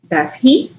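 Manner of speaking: usual speaking voice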